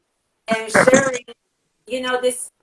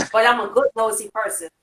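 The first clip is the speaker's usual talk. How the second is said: usual speaking voice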